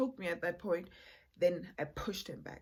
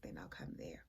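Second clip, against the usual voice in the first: whispering; 14 semitones lower, 14 dB quieter, faster than usual. Retold or imitated. Whispered reply quoted to partner.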